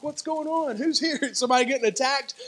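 high, hurried worried questions; mock alarm. Congregation looking back at the screaming, mock alarm.

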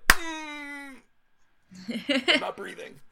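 mock breathless dread; tense, breath held. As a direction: act out holding your breath in suspense. Tense movie moment acted out.

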